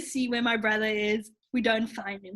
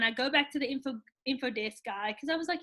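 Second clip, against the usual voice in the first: ordinary talk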